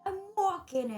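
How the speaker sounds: New York accent. Shown as a demonstration. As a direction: bark it like a New York cabbie. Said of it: "I think that's from a film".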